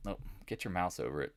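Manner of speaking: ordinary talk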